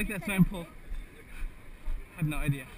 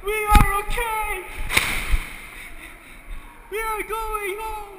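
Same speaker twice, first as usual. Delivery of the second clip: dramatic proclamation; 8 semitones higher, 4 dB louder, slower than usual. Pretend. Mock dramatic survivor declaration.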